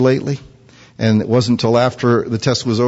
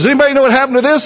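much higher, fast suspicious mom; mom's question; 12 semitones higher, 3 dB louder, much faster than usual. Retold or imitated. Mom asking kids about the cracked item, high and fast.

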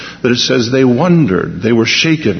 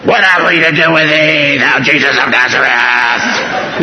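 screaming demon. Acted. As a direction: scream it like a possessed man in torment. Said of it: "this was a demon screaming".

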